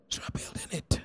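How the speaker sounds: quiet confiding whisper. Real, not acted. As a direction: breathe it as a hushed aside. Whispered aside.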